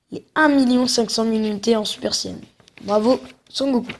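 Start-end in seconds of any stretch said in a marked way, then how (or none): 2.8-3.9 put-on Japanese accent. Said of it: "j'arrête avec mon accent japonais".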